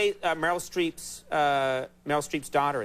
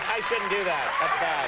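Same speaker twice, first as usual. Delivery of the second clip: normal talking voice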